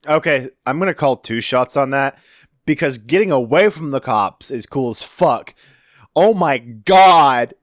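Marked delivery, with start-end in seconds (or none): 6.2-7.5 loud, high, drawn-out awe; amazed exclamation. Real, not acted. Listener hears the woman's defiance, reacting in loud amazement. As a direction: stretch it out in real amazement.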